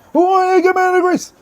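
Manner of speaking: high, loud mocking voice of a DJ begging for payment; mock greedy DJ. Radio DJ mocking DJs who want bribes, high loud mock voice. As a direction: ham up the greedy DJ holding out his hand.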